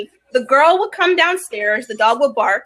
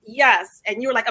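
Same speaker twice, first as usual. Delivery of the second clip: usual speaking voice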